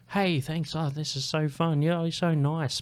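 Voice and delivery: imitating a stripper's put-on delight; high-pitched, sugary fake enthusiasm; mock enthusiastic. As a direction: gush as if paid to be thrilled. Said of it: "They do enthusiastic".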